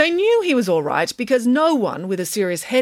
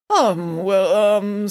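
hesitant, stumbling filler words; stuttering; 3 semitones higher, much slower than usual. A narrator's reading. Mister Green asked about his own children, stuttering. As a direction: fumble for an excuse. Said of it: "stuttered Mister Green".

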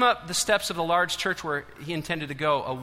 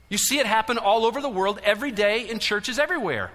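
loud, higher-pitched preacher's call; preaching at full force; 6 semitones higher, 4 dB louder than usual. Acted. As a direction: drive the warning home to the church. Preaching, rising intensity.

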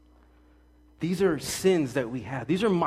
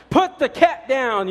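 higher, louder and slow; hammed-up yell; 10 semitones higher, 9 dB louder, slower than usual. Pastor imitating a parent yelling before church, slow loud yell. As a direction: yell it across the house. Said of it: "yelling at your wife to hurry up".

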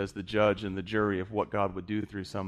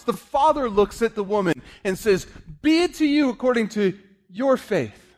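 1 preaching at full force; 10 semitones higher, 6 dB louder than usual. Acted.

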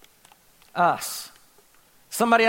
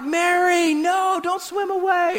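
high, drawn-out pleading call; mock calling after her; 10 semitones higher, slower than usual. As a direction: call out as if she's drifting away. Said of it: "And we're like".

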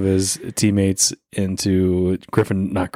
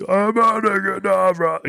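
high, slow, drawn-out grand curse; hammed-up wizard with a huge stick; big staff spell cast; 12 semitones higher, slower than usual. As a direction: wield a giant staff and boom the spell. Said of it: "they have a big giant stick".

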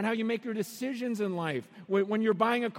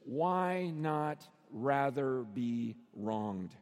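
low, word-by-word delivery; very slow, deliberate and grave; slow, weighty question; 8 semitones lower, much slower than usual. Acted. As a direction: space out each word solemnly. Solemnly sets out five key words.

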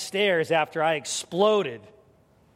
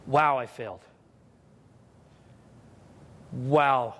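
low, rueful self-blame; tally the damage, low and deflated; dejected self-talk; 5 semitones lower than usual. Acted. Parent counts the cost of exploding, low rueful self-talk.

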